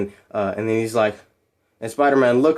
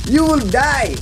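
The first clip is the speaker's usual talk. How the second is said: higher, badly faked Russian accent; attempted Russian accent; 10 semitones higher, 3 dB louder, slower than usual. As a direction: try a Russian villain's accent. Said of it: "What's a Russian accent?".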